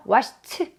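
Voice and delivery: "washed" finished on a released t; t ending. Shown as a demonstration. Teacher telling learners how to say it, "washed" with t ending. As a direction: say "washed" as wash-t. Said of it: "라고 발음을 해주시고".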